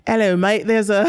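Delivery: badly faked Australian accent; awful Aussie accent. Player imitating her Australian assistant, awful Aussie accent. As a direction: put on a hammy Aussie accent, about to crack up. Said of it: "it's an awful accent".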